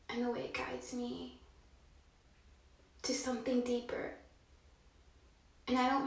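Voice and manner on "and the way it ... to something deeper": breathy hushed whisper; a soft whisper drifting off. Real, not acted. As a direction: breathe it out almost silently. Poetic musing by the bath.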